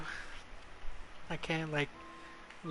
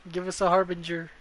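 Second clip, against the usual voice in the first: usual speaking voice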